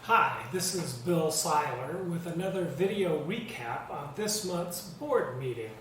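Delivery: ordinary delivery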